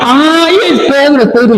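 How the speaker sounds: very high, thrilled squeal; excited cry. Retold or imitated. Rode crying out to the praying church, very high thrilled squeal. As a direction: burst out with giddy joy.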